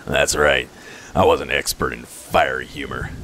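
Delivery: low, pleased wisecracker; low-voiced joker owning the pun; character voice. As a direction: drop the pitch and chuckle into it. Ragongar's joke answered in character.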